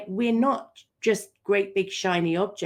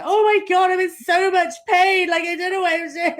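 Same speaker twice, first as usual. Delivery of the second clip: high, loud panicked cry of pain, faster at the end; panicked wail; 10 semitones higher, 10 dB louder than usual. Retold or imitated. Friend on a call, hammed-up panicked cry. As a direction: wail it out like everything just fell apart. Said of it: "three weeks later".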